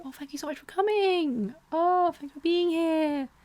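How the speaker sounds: high, sing-song mock thank-you; mock gracious author. What she should have said to buyers, high mock gushing. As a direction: gush in a high fake-sweet voice. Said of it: "Just like a".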